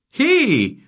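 high, loud giant "hey"; grumbled call. Acted. Giant catching up with the boy, grumbling call. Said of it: "brummte Gork".

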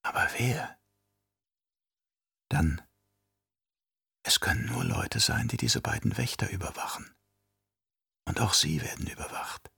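hushed, whispery reasoning to herself; very soft inner voice; inner thought. A narrator's reading. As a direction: whisper it as a private deduction. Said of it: "dachte sie".

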